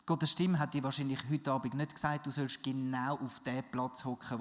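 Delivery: normal talking voice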